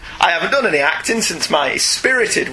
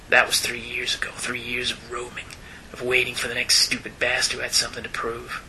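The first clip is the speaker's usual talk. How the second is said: Clint Eastwood; 3 semitones lower, 8 dB quieter than usual. Pretend. Host reads the old gunfighter's speech in a Western voice. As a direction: play a tired old gunfighter, hushed and low. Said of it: "I've gotta do my best Clint Eastwood".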